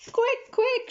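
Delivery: high urgent yell; urgent shout. Acted. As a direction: hurry them with a shout. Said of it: "shouted Mama".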